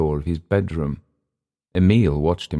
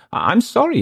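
very high, stammered apology; flustered apology; 4 dB louder than usual. Acted. Englishman apologizing to his companion, flustered and high. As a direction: stammer it out, voice shooting up.

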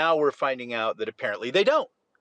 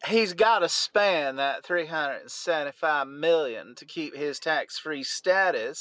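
put-on lightly done Southern Belle accent; a hint of a purring Southern Belle accent; 2 semitones higher than usual. Co-host on request, a light mock Southern Belle accent. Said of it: "in your Southern Belle accent".

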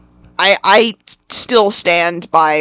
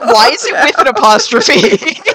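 higher, breaking into laughter; laughing disbelief; 5 semitones higher than usual. Retold or imitated. Co-host quoting their reaction to the title, laughing in disbelief. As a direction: retell a baffled question through laughter.

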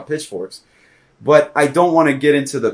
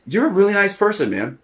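mock friendly; 9 semitones higher than usual. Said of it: "or it's like".

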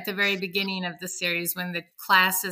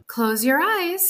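higher, slow, sugary teacher; sweet teacher voice; 7 semitones higher, slower than usual. Acted. Reading aloud, the teacher Miss Day speaks sweetly to her class. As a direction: coo it gently like a kind teacher. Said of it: "make Miss Day's voice so sweet".

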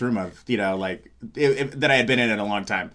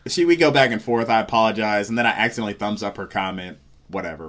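ordinary talk

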